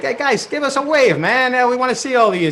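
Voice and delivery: usual speaking voice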